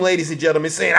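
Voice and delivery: ordinary talk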